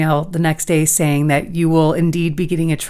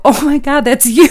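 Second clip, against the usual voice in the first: excited; 7 semitones higher, 5 dB louder than usual. Retold or imitated. Retelling her shock at win.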